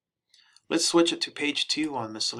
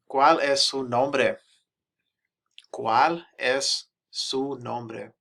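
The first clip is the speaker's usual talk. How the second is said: slow teaching read; 2 semitones higher, 5 dB louder, much slower than usual. Shown as a demonstration. Repeating phrase slowly for learners.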